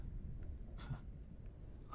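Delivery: a quiet, breathy villain laugh under the breath; low hushed sinister chuckle; soft dark chuckle. Acted. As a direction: chuckle to yourself, hushed and menacing. Villain taunting the hero.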